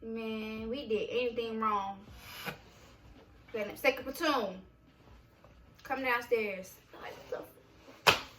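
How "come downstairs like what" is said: ordinary talk, nothing marked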